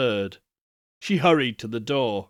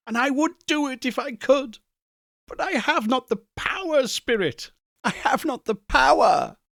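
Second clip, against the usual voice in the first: much higher, fast anguished plea; desperate Scrooge; 12 semitones higher than usual. Acted. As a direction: cry out your helplessness.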